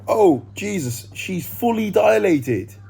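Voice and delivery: high, loud, slow gasp of shock. Retold or imitated. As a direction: blurt it out in startled disbelief. Retold midwife's shock.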